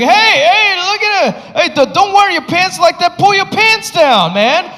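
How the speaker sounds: high, slow taunting bully; a kid heckling a classmate, high and slow. Retold or imitated. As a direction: goad him like a schoolyard bully. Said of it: "he's like".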